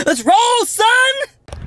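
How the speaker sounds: very high squealed rallying call; hyped battle cry. Pretend. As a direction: squeal it out to launch the errand. Hyped send-off before leaving.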